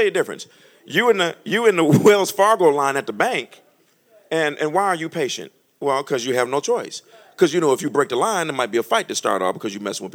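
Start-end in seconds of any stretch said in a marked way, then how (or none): none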